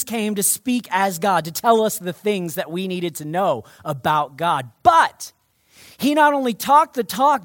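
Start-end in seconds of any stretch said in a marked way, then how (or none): none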